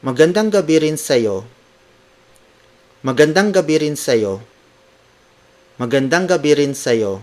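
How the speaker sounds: Magandang gabi rin sa'yo lifted and pressed out; emphatic greeting. Shown as a demonstration. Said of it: "when you want to emphasize".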